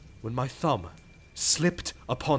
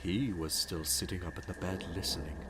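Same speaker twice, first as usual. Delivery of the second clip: breathless whisper; 9 semitones lower, 11 dB quieter than usual. Acted. Madman frozen in the old man's doorway, whispering. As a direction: barely breathe the words so he won't hear. Hiding motionless in the dark.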